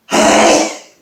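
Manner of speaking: big explosive sneezes; loud sneezes. Real, not acted. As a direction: sneeze hard, one after another. Filming an afternoon sneezing fit.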